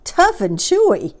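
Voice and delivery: high, loud outraged cry; cried in disbelief. Acted. Enormous crocodile crying out at his friend. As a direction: cry it out, aghast. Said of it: "cried the enormous crocodile".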